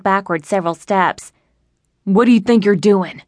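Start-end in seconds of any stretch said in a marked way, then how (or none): 2.0-3.2 a gruff, slightly louder challenge; grunted threat. A narrator's reading. Robber confronting the woman who helped, gruff grunt. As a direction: menace the narrator for helping. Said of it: "he grunted".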